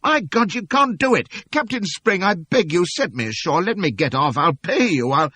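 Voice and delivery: very high, loud desperate begging; frantic pleading. Acted. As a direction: beg for your life. Begging the captain to release.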